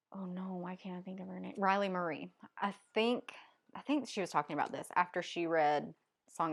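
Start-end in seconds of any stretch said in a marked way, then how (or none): 0.1-1.5 fast, hushed mutter; whispering to self. Real, not acted. Reviewer blanking on a name, hushed self-talk. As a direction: whisper it quickly under your breath.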